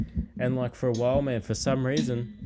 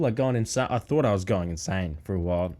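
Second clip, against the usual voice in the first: ordinary talk, nothing marked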